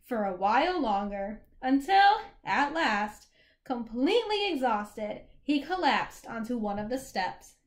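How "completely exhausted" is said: plain reading voice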